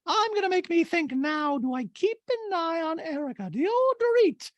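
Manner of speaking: extremely high caricature; Dorit impression. Dorit wondering about watching Erica, squeaky high voice. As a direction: squeak through it in mock musing. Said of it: "Dorito the Talking Head goes".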